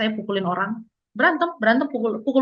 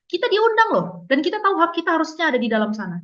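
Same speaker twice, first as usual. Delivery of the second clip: ordinary delivery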